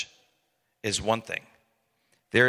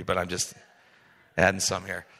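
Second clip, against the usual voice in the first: ordinary talk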